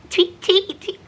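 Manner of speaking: high laughing tweet-tweet imitation; giggly mock birdcall; bird tweets. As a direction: chirp it like a little bird through a laugh. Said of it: "He sounds like a bird where he's like".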